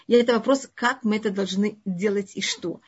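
usual speaking voice

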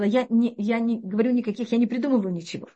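normal talking voice

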